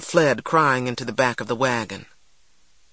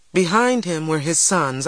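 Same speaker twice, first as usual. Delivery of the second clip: ordinary delivery, nothing marked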